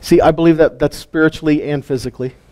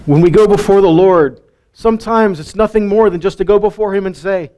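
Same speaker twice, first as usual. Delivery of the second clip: ordinary talk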